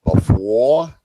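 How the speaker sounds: teasing his Kiwi friend by parroting the word, mock accent. Shown as a demonstration. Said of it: "you weren't too bad before".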